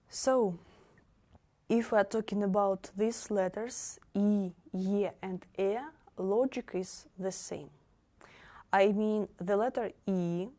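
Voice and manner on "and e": usual speaking voice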